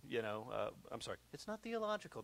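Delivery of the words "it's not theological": a hint of a stiff, high-pitched reader; dull reader impression. Preacher lightly mimicking the reader of a Spurgeon sermon. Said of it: "That's how that guy talked".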